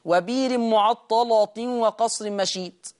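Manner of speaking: وبير معطلة وقصر مشيد بتؤدة وبلا همز; رتل وبير بطيئا بلا همز; قراءة ورش بالإبدال. Shown as a demonstration. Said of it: "يقرأ ورش بالإبدال". (تلاوة بطيئة بالإبدال)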